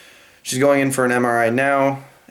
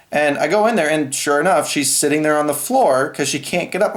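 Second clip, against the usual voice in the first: usual speaking voice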